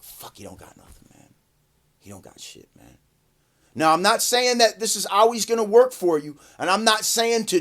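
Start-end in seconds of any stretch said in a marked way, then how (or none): 0.0-3.0 whispered, dismissive aside; very soft, low grumble under the breath; imitated muttering cops. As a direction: whisper it as if to your partner. Cops muttering among themselves.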